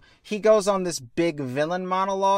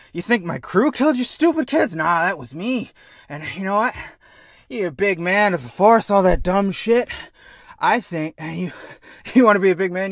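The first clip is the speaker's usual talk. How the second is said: taunting villain; 5 semitones higher, 6 dB louder than usual. Retold or imitated. Villain dad taunting captain.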